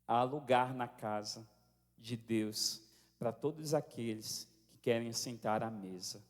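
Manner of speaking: low, slow, measured preaching; solemn exhortation. Real, not acted. Pastor inviting the congregation to the Lord's table, solemn. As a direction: bring the congregation gently down to a reverent close.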